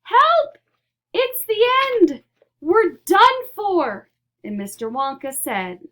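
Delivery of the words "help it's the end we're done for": very high, loud terrified cry, last words slow; panicked shout. Acted. Grandpa Joe panicking as they crash through the roof, terrified shout. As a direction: scream for help as the roof shatters. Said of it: "and Grandpa Joe shouted".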